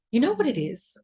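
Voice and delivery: normal talking voice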